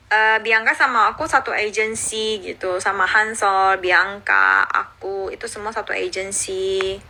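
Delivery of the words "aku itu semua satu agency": ordinary talk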